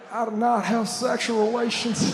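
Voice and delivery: mock-seductive Bill Clinton. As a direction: purr it at the audience like Clinton. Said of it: "sounded like he was trying to seduce the audience".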